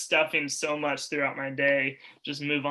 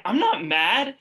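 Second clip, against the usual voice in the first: retold angry denial; 6 semitones higher, 4 dB louder, slower than usual. To friends in the hallway, a defensive raised denial. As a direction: insist I'm not mad, raised and pitched up. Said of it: "I'm, like, yelling at them".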